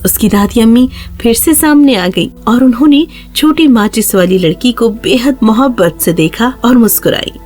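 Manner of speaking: ordinary delivery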